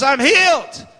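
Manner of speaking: high, joyful outburst; jubilant announcement. Retold or imitated. Woman calls her son about healing, jubilant announcement. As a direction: belt out the good news.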